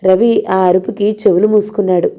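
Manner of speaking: ordinary talk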